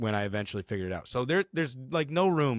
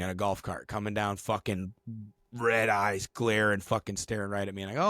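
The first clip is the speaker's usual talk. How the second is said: ordinary talk, nothing marked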